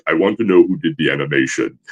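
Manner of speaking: low, commanding actor voice; Cary Tagawa impression. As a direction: drop low and speak with grave authority. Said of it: "he's got that voice".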